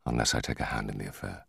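low, quick, soft mutter; scheming aside under the breath. A narrator's reading. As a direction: drop it low and quiet, half to yourself. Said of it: "he muttered".

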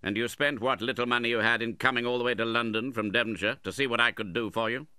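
impatient interrogation. A narrator's reading. Uncle reproaches widow for coming to London, impatient. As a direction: rattle it off reproachfully.

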